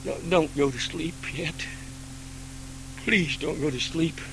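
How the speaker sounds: hushed, whispered pleading; God at a believer's bedside, whispered plea; soft late-night plea. Acted. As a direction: murmur it like someone at your bedside. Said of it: "tap you on the shoulder?".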